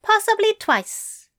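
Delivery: teasing Aziraphale voice; playful tease. Acted. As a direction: dangle it with a wink. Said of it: "Crowley grinned broadly".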